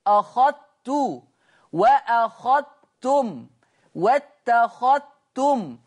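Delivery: "أخذت" بإدغام الذال في التاء ببطء; تُنطق "أخذت" بتاء مشددة بلا ذال; أخذت بالإدغام. Shown as a demonstration. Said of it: "مفيش بقى ذال". (تلاوة بطيئة لـ"أخذت" مدغمة)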